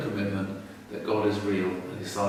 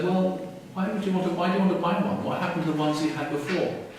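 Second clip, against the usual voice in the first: much higher-pitched challenge; incredulous questioning; 9 semitones higher than usual. Retold or imitated. Abraham questions the old lady buying an idol, incredulous. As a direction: question her as if baffled by the purchase.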